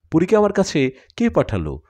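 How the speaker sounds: usual speaking voice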